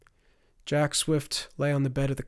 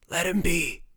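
higher, strained dying rasp; 5 semitones higher than usual. A narrator's reading. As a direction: force it out through a burned throat. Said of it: "said a rasping voice".

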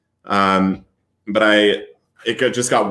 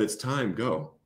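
hushed, much softer quote; retold whisper; 11 dB quieter, slower than usual. God repeating himself to a crying man, retold soft whisper. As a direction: drop right down, a quiet command. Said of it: "repeats himself".

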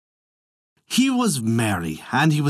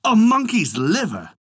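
shocked cry; 10 semitones higher than usual. A narrator's reading. Dragon King to his sick queen, a shocked cry. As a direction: throw the question out in alarm. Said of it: "cried the Dragon King".

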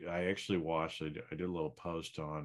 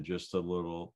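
ordinary talk

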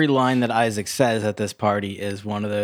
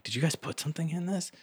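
high-pitched, hushed stoned voice; mock stoned wariness, small and high; acting high; 8 semitones higher, 8 dB quieter than usual. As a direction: softly squeak it out like someone suspiciously stoned. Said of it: "starts to get really high".

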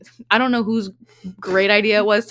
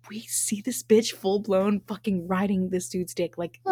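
slow scandalized whisper; 4 dB quieter than usual. Real, not acted. Telling her co-host what she saw through the window, whispering. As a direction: hush it like gossip too shocking to say aloud.

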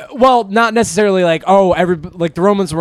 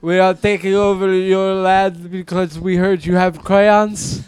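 slow, higher, dim-witted invader; dopey conqueror voice; 3 semitones higher, slower than usual. Acted. Podcast host mocking conquerors in a dopey warlord voice. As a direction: play a dim warlord hungry for crayons.

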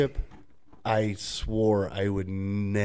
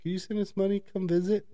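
high, whiny begging imitation; hammed-up needy scammer; mock whiny pleading; 11 semitones higher, 5 dB quieter than usual. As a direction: whine it up very high. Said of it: "they get upset".

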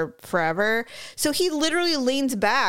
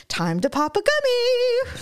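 put-on older lady next seat; 14 semitones higher than usual. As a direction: imitate a gleeful older woman piping up. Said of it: "the lady sitting next to me".

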